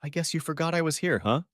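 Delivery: a touch of hurt through a smirk; pained smirk. A narrator's reading. Houseguest tells her host he forgot her, mildly pained. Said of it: "She gave me a pained smirk and said".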